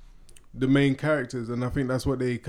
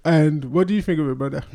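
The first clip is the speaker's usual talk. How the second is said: ordinary talk, nothing marked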